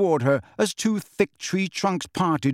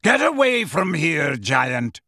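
raised, commanding call; slower than usual. A narrator's reading. Megorian warning off the giant, calling. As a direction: call it up forcefully, dropping on the last word. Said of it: "called Megorian".

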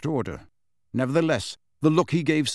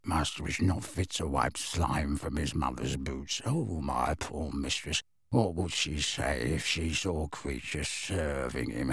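low, soft, seething mutter; furious mutter; 7 semitones lower, 7 dB quieter, slower than usual. A narrator's reading. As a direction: spit it out under the breath. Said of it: "muttered furiously".

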